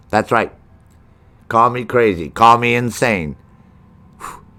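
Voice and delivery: loud hard-sell car-lot ad voice; mock commercial hawking free gunboats; infomercial pitch. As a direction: sell it like a pushy TV salesman. Fake boat sales pitch.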